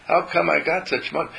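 delighted disbelief at the money; amazed surprise. Retold or imitated. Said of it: "You say".

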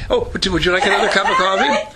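belated eager offer. Retold or imitated. Group member belatedly offering the leader coffee, mock eager. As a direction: imitate someone suddenly remembering to offer.

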